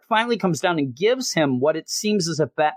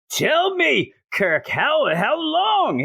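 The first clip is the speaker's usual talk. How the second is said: high-pitched mock scream of a demand; half-joking shout, pitch jumping up; imitating a furious Batman, hammed-up; mock yelling; 12 semitones higher, much slower than usual. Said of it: "screaming at the top of his lungs".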